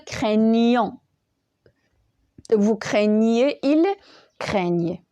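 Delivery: CRAIGNANT, CRAIGNEZ, CRAIGNAIT very slow with a clear "ny"; slow model forms, "krè-nyon, krè-nyé, krè-nye"; correct gn sound. Shown as a demonstration. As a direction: sound out each craign- form, stretching into the palatal gn. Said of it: "il va donner ce, le, le son nieux".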